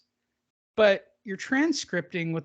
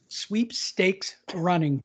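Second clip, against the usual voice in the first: careful slow repeat; 2 semitones lower, much slower than usual. Shown as a demonstration. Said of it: "That, that was a tongue twister".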